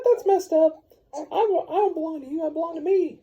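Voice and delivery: voce molto acuta e indignata; high indignant lady. Acted. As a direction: protest in a squeaky offended voice. Lady objects to being given.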